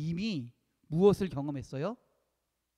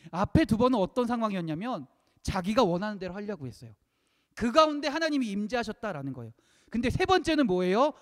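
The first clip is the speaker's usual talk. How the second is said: raised, energetic preaching; 6 semitones higher, 7 dB louder than usual. Real, not acted. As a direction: build the contrast with a lifted, forceful voice. (목소리를 높여 힘 있게 설교)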